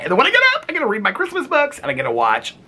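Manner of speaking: can't contain the joy; giddy excitement. Real, not acted. Excited plans.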